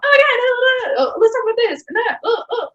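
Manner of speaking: high, fast, tumbling excited babble; excited rush. Retold or imitated. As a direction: spill every word out at once in excitement. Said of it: "when I get excited".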